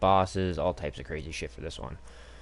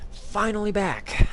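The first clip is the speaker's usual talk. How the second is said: excited; 13 semitones higher, 6 dB louder, slower than usual. Real, not acted. Streamer greeting returning viewers.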